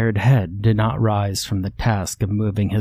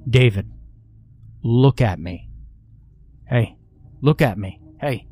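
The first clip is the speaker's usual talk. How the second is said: firm, repeated demand. Acted. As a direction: press him firmly. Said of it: "I held a firm tone".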